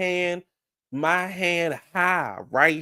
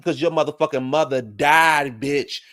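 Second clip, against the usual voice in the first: ordinary talk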